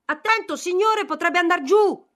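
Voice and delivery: high, loud alarmed cry; warning shout. Acted. Felipe warns the count of the drop: alarmed shout. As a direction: yell a warning before he falls. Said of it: "Felipe gridò con una voce".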